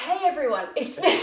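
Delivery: ordinary talk, nothing marked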